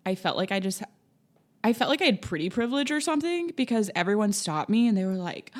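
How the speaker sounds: usual speaking voice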